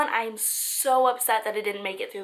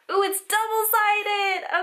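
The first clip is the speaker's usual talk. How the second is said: delighted; 9 semitones higher, 4 dB louder, slower than usual. Real, not acted. Delighted by a letter.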